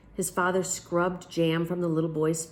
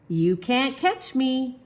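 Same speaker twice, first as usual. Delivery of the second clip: put-on slow, high, loud child taunt; teasing shout; 8 semitones higher, 5 dB louder, much slower than usual. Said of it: "he shouted".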